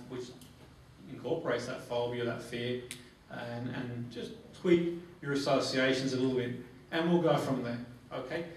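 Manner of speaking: usual speaking voice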